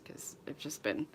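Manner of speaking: whispered aside. Real, not acted. To viewers about her messy sewing area, whispered. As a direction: drop to a whisper.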